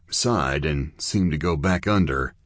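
ordinary delivery, nothing marked

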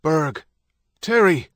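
man calling names across the yard. Acted. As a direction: call out to the kids. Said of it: "A man's voice called".

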